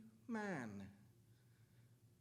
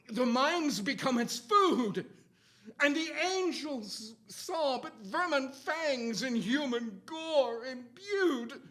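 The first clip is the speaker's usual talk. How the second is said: rising dramatic reading; 5 semitones higher, 6 dB louder than usual. Acted. Climax of dramatic poem reading.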